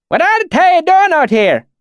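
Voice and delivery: high, loud, wary backwoods dialect; suspicious valley accent. A narrator's reading. Swineherd questions strangers, suspicious thick dialect. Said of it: "he said suspiciously".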